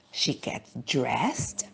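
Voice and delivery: slow secretive whisper; whispered aside in a picture book; storyteller whisper. A narrator's reading. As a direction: hush it down, slowly. Reading bedtime story to children.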